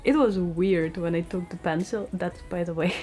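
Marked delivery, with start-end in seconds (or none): none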